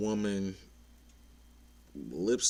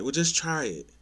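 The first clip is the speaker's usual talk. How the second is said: pushy insistence; 7 semitones higher, 5 dB louder than usual. Retold or imitated. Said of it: "And they be like".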